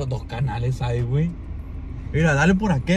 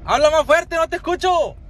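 high raised voice, pushing to be heard; calling into phone; 9 semitones higher than usual. Real, not acted. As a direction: gritando al teléfono para que lo oigan. Shouting into the phone. (habla fuerte y agudo por el teléfono)

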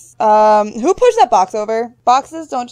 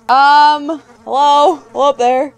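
higher, louder questioning hello; calling out; 3 semitones higher, 5 dB louder, slower than usual. Real, not acted. Player calling to whoever moves the rope, calling out. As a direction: call up to someone out of sight.

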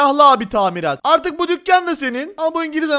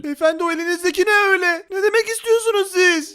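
higher-pitched, alarmed questioning; mock alarm; 5 semitones higher than usual. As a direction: play startled at an armed stranger. Threatened by armed man in game.

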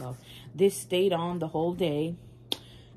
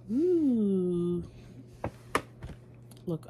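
usual speaking voice

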